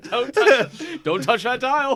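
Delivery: high, loud radio promo; excited announcer. Acted. Announcer parody.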